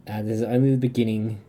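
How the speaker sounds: ordinary talk, nothing marked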